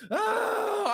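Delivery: high, soft cry of relief; release sigh. Real, not acted. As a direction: let the rant go. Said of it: "there's my rant".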